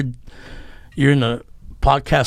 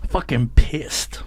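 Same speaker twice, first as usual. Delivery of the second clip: slow, seething whisper; slow angry whisper; fuming whisper; much slower than usual. Real, not acted. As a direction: breathe it out slowly through rage. Fuming over the wrong drink.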